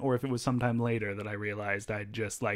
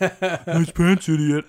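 higher, louder dopey parrot of an insult; dumb mocking voice; 6 semitones higher, 9 dB louder, much slower than usual. Kid repeating a bully's insult back at him, dumb mocking voice. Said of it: "saying in a really dumb voice".

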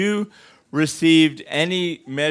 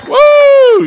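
excited whoop; 9 dB louder than usual. Real, not acted. Cheering healing in crowd.

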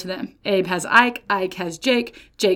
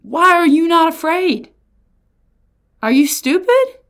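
higher, louder demand; exasperated challenge; 7 semitones higher, 4 dB louder than usual. Real, not acted. Preacher scolding the crowd for not fearing the warning. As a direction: shake them awake. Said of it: "Amos is sounding that trumpet, y'all".

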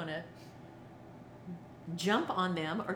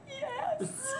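laughing yes. Retold or imitated. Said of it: "And I said".

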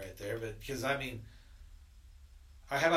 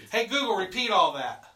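raised voice to a smart speaker; loud command; 7 semitones higher, 9 dB louder than usual. Real, not acted. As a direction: call out clearly to the device.

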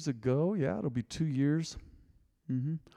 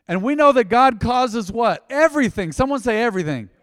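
loud, high scripture proclamation; full-force preaching; 9 semitones higher, 11 dB louder than usual. Real, not acted. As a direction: belt it out to rouse the congregation.

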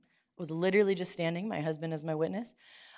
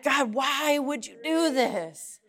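anguished cry; 7 semitones higher, 4 dB louder, much slower than usual. Retold or imitated. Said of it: "It's the utterances".

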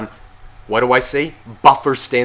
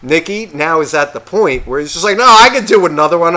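loud, higher "no, no, no"; loud refusal; 4 semitones higher, 7 dB louder than usual. Retold or imitated. Stopping another round of shots, loud refusal. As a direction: shout them down over the music.

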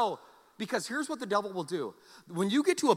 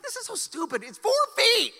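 scoffing; 6 dB louder than usual. Retold or imitated. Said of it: "I'm like".

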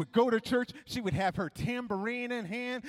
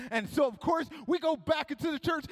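ordinary delivery

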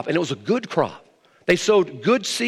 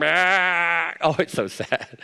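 ordinary talk, nothing marked

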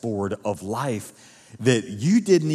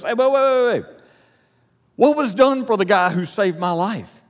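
much higher, louder sudden halt; startled king; 9 semitones higher, 6 dB louder than usual. Acted. Sleepless king stopping his reader, suddenly alarmed. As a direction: jolt upright as it suddenly clicks.

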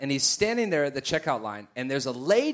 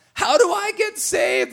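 high, drawn-out plea; imitating desperate woman; 3 dB louder than usual. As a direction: beg it slowly in a high mock-tearful voice. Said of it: "starts losing it".

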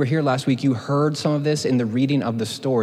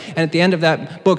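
ordinary talk

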